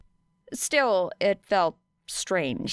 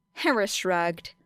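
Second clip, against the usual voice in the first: usual speaking voice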